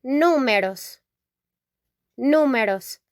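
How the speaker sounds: números stressed on nú; teacher modelling números; Spanish pronunciation. Shown as a demonstration. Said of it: "we say".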